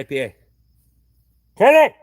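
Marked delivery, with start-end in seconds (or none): 1.6-1.9 loud long holler of a name. Retold or imitated. Calling out to someone far off, a loud holler. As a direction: holler across the yard. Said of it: "every time I fucking hollered at her".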